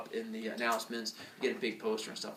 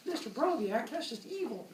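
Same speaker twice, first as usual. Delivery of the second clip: whiny student; 10 semitones higher, 3 dB quieter than usual. Retold or imitated. Student voiced protesting.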